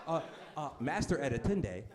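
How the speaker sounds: put-on lightly done African accent, pleading; whiny hint of an African accent. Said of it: "This is my African accent".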